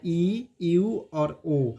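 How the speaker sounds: ई, यू और ओ said very slowly, each vowel held long; vowel sounds drawn out. Shown as a demonstration. Teacher recaps three vowel steps, each sound drawn out. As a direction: stretch out ई, यू और ओ one by one. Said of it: "ये तीन step पढ़ा है".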